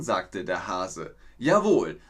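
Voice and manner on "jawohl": loud, keen reply; eager loud yes. Acted. Hedgehog calls back to the hare, loud and eager. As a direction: hedgehog shouting ready.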